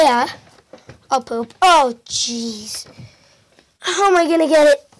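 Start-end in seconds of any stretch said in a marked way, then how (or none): none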